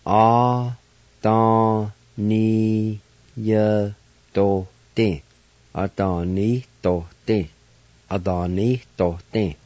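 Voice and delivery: A, da, ni, ya, do, din sounded out in beats; teacher sounding out; syllable by syllable. Shown as a demonstration. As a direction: break the word into syllables. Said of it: "I'll pronounce it here in just a moment".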